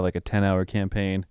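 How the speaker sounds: normal talking voice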